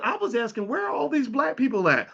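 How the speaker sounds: a bemused woman asking where they all are; incredulous colleague. Retold or imitated. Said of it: "She said".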